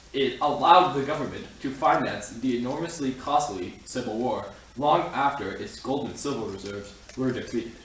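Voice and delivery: ordinary talk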